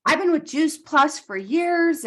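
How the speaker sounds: high, slow, put-on voice of the caller; mock clueless caller. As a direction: act the innocent outsider, high and drawn out. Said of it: "And she's like".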